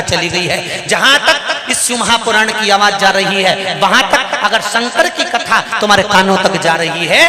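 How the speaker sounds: loud, high sermon delivery, driving each clause; fervent preaching. Acted. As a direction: preach it to the crowd with fervour. Preaching at full force. (जोशीला प्रवचन)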